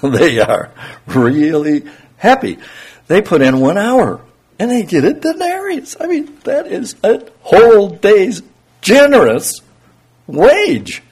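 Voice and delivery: high, loud delighted laborer; overjoyed worker. Acted. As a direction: burst out with a worker's glee at the pay. Happy late workers voiced.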